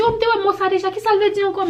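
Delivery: asking herself, bewildered and high; puzzled self-quote. Retold or imitated. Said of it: "mwen, m di".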